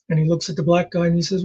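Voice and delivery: ordinary talk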